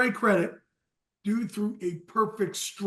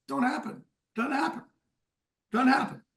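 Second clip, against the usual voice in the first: teasing boast; 6 semitones higher than usual. Real, not acted.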